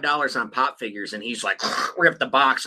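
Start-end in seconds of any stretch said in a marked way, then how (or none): none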